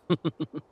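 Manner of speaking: short loud gloating laugh; villain laugh. Acted. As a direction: laugh in triumph.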